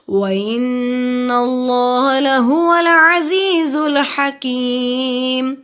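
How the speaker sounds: slow, drawn-out melodic tajweed recitation; Quran recitation. Acted. Teacher recites the verses to students in slow chant. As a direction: chant each verse slowly with long held vowels.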